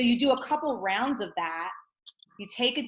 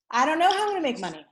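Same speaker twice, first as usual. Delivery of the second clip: panicky take; 7 semitones higher, 3 dB louder, much faster than usual. Shown as a demonstration. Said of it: "So it's like".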